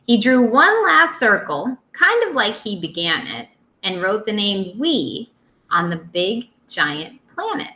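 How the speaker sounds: put-on lively storytime voice for young kids; reading a picture book aloud. Teacher reading to her second graders, lively storytime. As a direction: read it out to the class with bounce.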